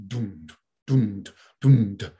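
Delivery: low, spaced "du-dum" thuds; imitated heartbeat. Shown as a demonstration. Teacher showing listeners your heartbeat, low thudding imitation. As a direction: imitate a steady heartbeat, dropping the pitch. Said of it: "So your heartbeat".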